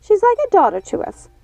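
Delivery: high, loud, frightened begging; fearful pleading. A narrator's reading. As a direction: beg high as you back away. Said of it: "backing away from Gothel in fear".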